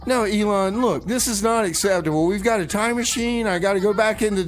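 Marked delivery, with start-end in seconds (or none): none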